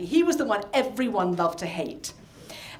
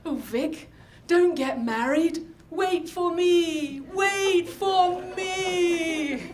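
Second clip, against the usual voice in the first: building to a high, long-drawn wail; lovesick inner plea; 7 semitones higher, much slower than usual. Retold or imitated. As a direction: plead dreamily, stretching the last words out and out. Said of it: "and I'd think".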